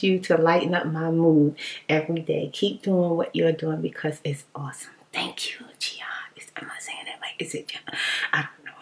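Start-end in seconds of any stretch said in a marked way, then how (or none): 2.5-8.7 hushed, voiceless thank-you; whisper. Real, not acted. Thanking a viewer and puzzling over a name, in a whisper. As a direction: whisper it close to the mic. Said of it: "whispering thanks and names".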